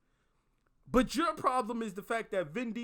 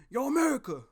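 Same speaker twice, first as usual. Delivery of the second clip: loud, higher-pitched livid greeting; livid friend; 4 semitones higher, 5 dB louder, faster than usual. Retold or imitated. Livid friend blasting into the phone on pickup, angry call-out. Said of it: "he's fucking livid".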